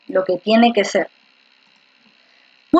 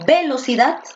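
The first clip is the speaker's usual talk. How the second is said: "velocidad" stressed on the first syllable, VE-locidad. Shown as a demonstration. Teacher testing syllables for the class, "velocidad" wrongly stressed on VE. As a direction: punch VE at the start of "velocidad". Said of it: "Porque tú acaso dices".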